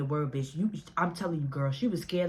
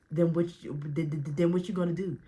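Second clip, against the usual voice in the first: stuttered retort, retold; acted stuttering. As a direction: stumble and repeat "then" before the question. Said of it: "I started startling just like that".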